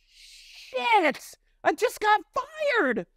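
very high, drawn-out mock squeal; stunned inner cry. Musician to himself on stage after being fired, high stunned squeal. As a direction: retell the shock slowly, in a strained high voice.

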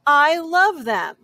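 plain reading voice

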